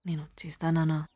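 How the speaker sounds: low, near-whispered plea; choked homesickness. Acted. Homesick daughter telling her father she is coming, choked up. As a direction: let the voice sink almost to a whisper.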